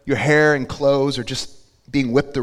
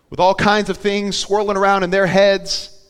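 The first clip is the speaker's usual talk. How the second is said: ordinary talk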